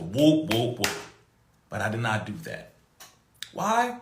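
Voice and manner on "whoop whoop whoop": low, deep voice. Shown as a demonstration. Showing listeners his deep natural voice. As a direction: drop into his deep normal tone. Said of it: "normal deep tone of my voice".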